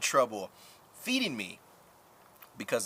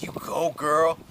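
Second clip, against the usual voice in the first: put-on you go girl; 5 semitones higher, 8 dB louder, much slower than usual. Cheering on women in awe.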